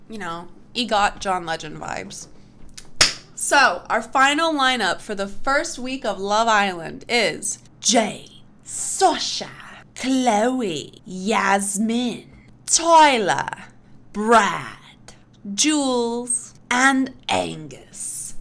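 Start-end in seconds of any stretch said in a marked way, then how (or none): 3.3-16.5 louder, punchy name-by-name announcing; hammed-up host reveal. Announcing the Love Island lineup. 16.7-18.3 very slow, drawn-out "and Angus" with a pause-heavy build; dramatic final reveal. Pretend. Last name of the lineup.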